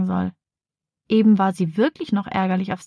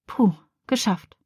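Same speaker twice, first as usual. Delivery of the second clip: ordinary delivery, nothing marked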